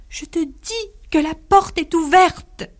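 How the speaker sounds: high, slow, pleading insistence; frightened insistence. Acted. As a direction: she is terrified and begs to be believed. Zulma insists in terror. (insistance effrayée, aiguë et lente)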